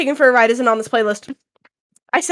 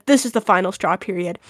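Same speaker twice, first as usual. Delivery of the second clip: low, softer deadpan reading; serious; 5 semitones lower, 4 dB quieter than usual. Retold or imitated. Reading Claire's punctuated reply to friends, serious. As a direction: lower it gravely, dropping away on the punctuation. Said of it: "you can tell this is serious".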